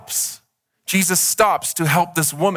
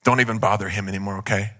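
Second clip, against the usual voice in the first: callous messenger; 6 semitones lower than usual. Acted. Messengers dismissively report the death.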